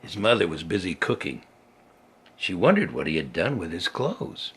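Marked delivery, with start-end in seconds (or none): none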